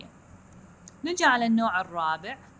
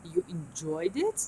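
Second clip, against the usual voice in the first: slow, stress on the second syllable of enjoyed, rising; teacher models the stressed syllable; slow intonation demo; 3 semitones lower, much slower than usual. Shown as a demonstration. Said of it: "the second syllable".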